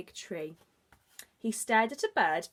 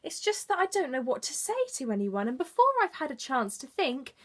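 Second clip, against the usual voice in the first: shy bear; 7 semitones higher, 5 dB louder than usual. Acted. Shy Bear explains himself.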